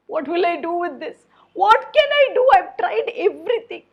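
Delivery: high, tearful despair; crying chef. Acted. Chef alone in the kitchen, crying in despair. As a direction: wail it through half-sobs. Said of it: "he started crying".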